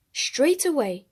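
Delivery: ordinary delivery, nothing marked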